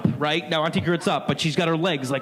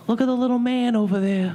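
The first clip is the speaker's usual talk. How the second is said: lightly voiced old lady; 8 semitones higher than usual. Senile aunt seeing a gnome.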